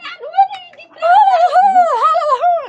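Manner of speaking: high burst of laughter; loud laugh. Real, not acted. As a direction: crack up loudly.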